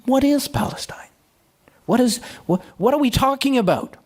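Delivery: high, whispered disbelief; a mock baffled whisper, like someone who has never heard of the place; puzzled French delegate. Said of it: "So the French guy says".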